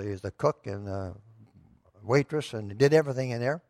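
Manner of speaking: usual speaking voice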